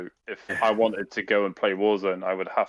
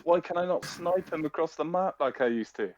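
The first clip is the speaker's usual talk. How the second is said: high, put-on voice of an indignant player; imitation outrage, pitched way up; mock yelling gamer; 7 semitones higher than usual. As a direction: mimic a baffled gamer moaning down the mic. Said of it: "would be a guy yelling".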